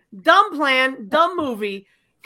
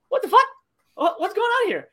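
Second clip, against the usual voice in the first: incredulous outburst; 11 semitones higher than usual. Real, not acted. Exasperated outburst at movie plot.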